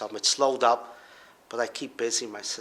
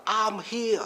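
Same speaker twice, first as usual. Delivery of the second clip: high mock yell from the back of the room; retold shout; 12 semitones higher than usual. Father calling out from the back of the courtroom, high mock yell. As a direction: call out to the court, pitched way up. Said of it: "So I scream out in court".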